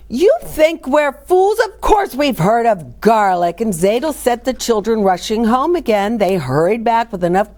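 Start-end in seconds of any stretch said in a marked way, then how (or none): 0.1-3.6 offended. A narrator's reading. Villagers offended.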